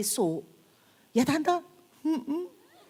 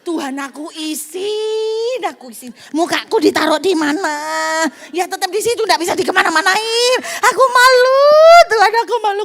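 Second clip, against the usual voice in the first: loud, higher sobbing cry to God; wailing prayer; 9 semitones higher, 12 dB louder than usual. Retold or imitated. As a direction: howl out the shame in tears. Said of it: "Masuk ke mobil saya nangis". (meratap keras kepada Tuhan)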